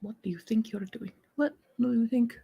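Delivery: put-on very soft trial run of a Middle Eastern accent; muttering the accent to find it; tryout of Taliba's voice. Trying out Taliba's Middle Eastern accent under her breath. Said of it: "We are Taliba Khalaf".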